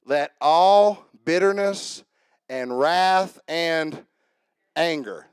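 drawn-out, weighty scripture reading; slow emphatic reading. Shown as a demonstration. Preacher reading Ephesians to the church, slow and emphatic. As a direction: lay each word down slowly, one at a time. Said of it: "I want you to notice there's an order here".

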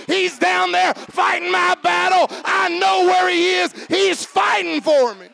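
high shouted preaching, building in pitch; full-force preaching. Real, not acted. Preacher telling Eddie God fights for him, full-force preaching. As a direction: drive it home at a shout.

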